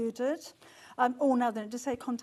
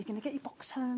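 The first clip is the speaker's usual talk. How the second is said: normal talking voice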